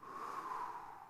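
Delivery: long audible exhale; big relaxing sigh; deep breath out. Shown as a demonstration. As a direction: let out a long, slow breath. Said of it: "Let's take a breath".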